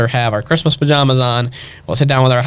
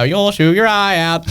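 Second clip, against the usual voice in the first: higher, slow, drawn-out warning; movie-line imitation; 7 semitones higher than usual. Christmas movie playing behind the presents, higher drawn-out warning. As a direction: quote it in a sing-out movie voice.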